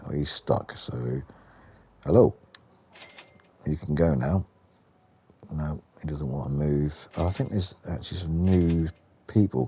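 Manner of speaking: ordinary talk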